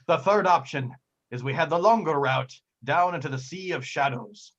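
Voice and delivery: put-on old sea captain warning his crew; measured pirate voice, lingering on the dangers; pirate captain, ominous. Captain offering the third route to a vote, ominous pirate voice.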